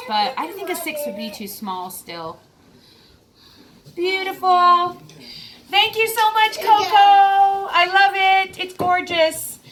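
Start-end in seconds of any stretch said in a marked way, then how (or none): 3.9-9.6 high, drawn-out, delighted gratitude; gushing thanks. Real, not acted. Vlogger thanking Coco for the ring, gushing. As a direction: gush with long, high, admiring words.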